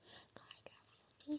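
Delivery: soft, slow whispered I love you. Real, not acted. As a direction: breathe it out tenderly, slow. Whispered sign-off.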